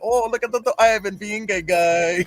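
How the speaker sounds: high mock voice of gawking relatives; Russian family. Russian family commenting on Ivan, high mocking impression. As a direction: imitate appalled relatives, dragging the last words. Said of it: "his family was hitting him up like".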